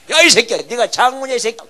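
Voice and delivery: loud, high-pitched angry outburst; angry cursing. Acted. Deacon lashes out at an elder, angry shouting. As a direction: explode at him in a mock tirade.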